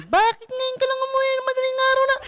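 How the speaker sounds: very high, loud scolding wife voice; shrill squeal of a wife scolding a late husband; mock-scolding wife in a piercing high pitch; ultrasonic nagging wife. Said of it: "Alam mo yung boses na".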